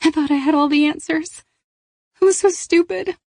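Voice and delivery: regretful, welling up; lightly voiced teen, a touch of tears; tearful Kat. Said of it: "Tears welled in her eyes".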